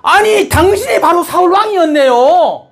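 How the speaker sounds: high, hammed-up cry of accusation; acted shouting. Medium confronts disguised King Saul fearing death, acted high shout. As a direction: cry it out at high pitch, as if horrified. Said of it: "고함 지르는 겁니다".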